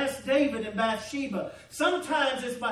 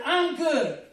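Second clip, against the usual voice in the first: laughing; 2 semitones higher, 3 dB louder than usual. Real, not acted. Repeats the thought, laughing.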